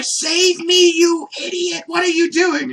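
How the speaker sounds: high, loud mock scream for help; loud, high, frantic yell; panicked Bulma. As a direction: shriek it as if being carried off. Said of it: "Bulma's just like".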